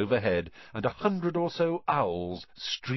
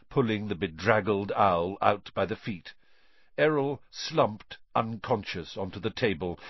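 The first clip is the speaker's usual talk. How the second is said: plain reading voice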